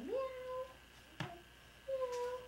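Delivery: high soft kitten mew; kitten meow. Shown as a demonstration. Mom showing her toddler how to meow, high and soft. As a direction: mew softly and high for the child to copy.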